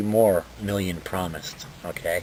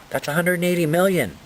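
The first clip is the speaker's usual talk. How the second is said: outraged; 10 semitones higher, 4 dB louder than usual. Real, not acted. Outraged at spending.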